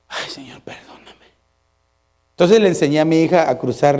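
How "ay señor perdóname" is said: very soft, low whisper; acted hushed plea for God's pardon; whispered penitent prayer. Acts a penitent prayer afterwards.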